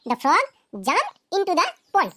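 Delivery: very slow, word by word for students to copy; slow dictation. Shown as a demonstration. Teacher reading an example to students, very slow and deliberate. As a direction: spell it out slowly like dictating to a class. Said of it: "teacher dictates example sentence".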